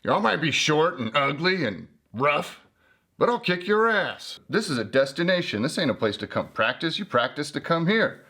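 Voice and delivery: cocky swaggering voice for Joshua Tree; Joshua Tree talking tough. Retold or imitated. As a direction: voice the desert as a cocky brawler. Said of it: "I could just hear Joshua Tree saying".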